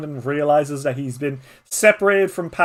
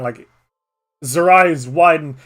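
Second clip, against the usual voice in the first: ordinary talk, nothing marked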